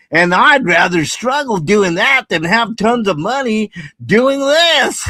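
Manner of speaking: high, loud hammed-up goofball voice with laughs breaking through; goober voice. Streamer reads chat's words of wisdom as a goober, hammed-up high loud goofy voice. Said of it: "Okay, I'll do a goober voice".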